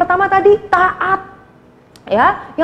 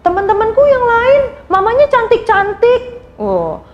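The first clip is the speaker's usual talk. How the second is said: put-on whiny child Rita; 6 semitones higher than usual. Rita complaining to grandma, in a higher whiny child voice. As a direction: whine like a hurt little girl. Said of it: "Terus dia bilang".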